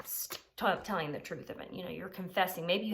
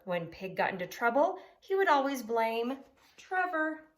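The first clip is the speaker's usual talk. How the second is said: lively, louder storybook reading; reading to children; 3 semitones higher, 4 dB louder than usual. Acted. Teacher reads aloud to the class in a lively storybook voice. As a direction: read it big for a class of kids.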